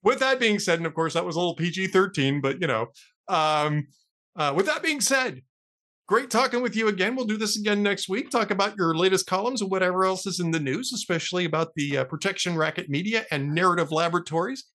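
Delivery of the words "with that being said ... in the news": ordinary talk, nothing marked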